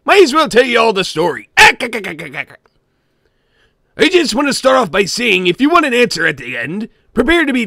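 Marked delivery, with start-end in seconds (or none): 1.6-2.6 short choppy laugh from the throat; a little gurgling throat chuckle; small throat laugh. Retold or imitated. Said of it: "did a small throat laugh at it".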